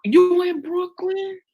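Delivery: put-on high, slow New York accent question; mock New York accent. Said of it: "I'm talking like I'm from New York".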